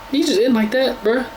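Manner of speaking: high-pitched, incredulous protest. Real, not acted. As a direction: complain in high-voiced disbelief. Reacting to a sudden episode ending.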